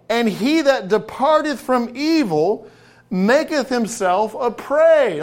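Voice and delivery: fervent preaching. Acted. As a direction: preach it out to the congregation. Preaching the verses with force.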